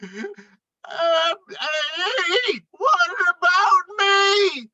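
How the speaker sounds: a high, slow, plaintive wail from inside the walls; muffled cry. Acted. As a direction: cry out, trapped and forgotten. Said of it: "Moose muffled voice cried".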